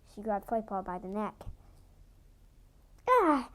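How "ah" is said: loud sharp grunt of surprise; surprised grunt. Acted. Flightpaw grunting when grabbed by the neck. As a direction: yelp as she's grabbed. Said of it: "Flightpaw grunted".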